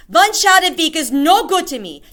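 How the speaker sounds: high, loud scornful witch; witch crying out. Acted. Grand High Witch crying out at feeble witches. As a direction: dismiss their efforts. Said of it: "the Grand High Witch cried out".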